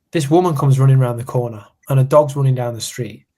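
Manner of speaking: usual speaking voice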